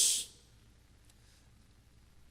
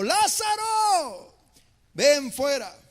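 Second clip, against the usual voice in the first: loud cry; 2 semitones higher, 4 dB louder, much slower than usual. Retold or imitated. Jesus crying out to Lazarus at the tomb, loud. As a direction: call out to the dead man. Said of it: "clamó a gran voz y gritó".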